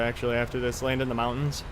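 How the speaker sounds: usual speaking voice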